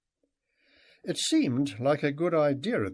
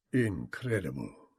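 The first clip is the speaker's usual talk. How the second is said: low, soft murmur of wonder; awed guide murmuring to himself; quietly to himself; 9 semitones lower, 6 dB quieter than usual. A narrator's reading. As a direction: say it under your breath. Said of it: "said Tulga quietly to himself".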